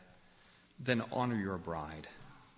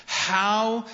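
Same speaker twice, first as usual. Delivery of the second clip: full-force preaching; 6 semitones higher, 6 dB louder than usual. Acted. Pastor urging the men in the congregation, forceful preaching. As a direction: drive it home from the pulpit.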